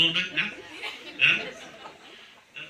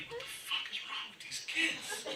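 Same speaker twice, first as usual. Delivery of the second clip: high, slow, softer whisper; whispered disbelief; 11 semitones higher, 17 dB quieter than usual. Retold or imitated. Cab driver eavesdropping on passengers, whispering in horrified disbelief. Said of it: "'cause I'm like".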